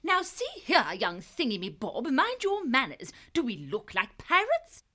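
high-pitched scolding female character; high, indignant reprimand; confronting. A narrator's reading. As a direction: tell off a rude youngster in a high voice. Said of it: "Rosie Woodsaddle confronted him".

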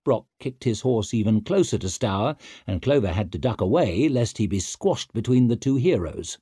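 usual speaking voice

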